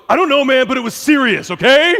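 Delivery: high and loud, insisting it mattered; defensive inner voice. Retold or imitated. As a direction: play the stubborn angry side of yourself. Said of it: "the other part goes".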